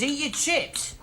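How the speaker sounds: high-pitched mocking quote of unwanted advice. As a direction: throw the advice back, pitched right up. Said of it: "all you can say is".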